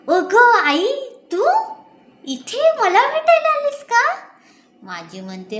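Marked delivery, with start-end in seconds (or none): 0.1-4.1 much higher, louder cry of surprise; delighted to see her mother arrive; joyful exclamation; surprised daughter. A narrator's reading.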